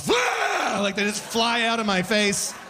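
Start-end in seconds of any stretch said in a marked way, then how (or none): 0.1-0.8 high shouted sudden sneeze; old man sneeze. Retold or imitated. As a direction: blast out a sneeze. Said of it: "old man sneezes".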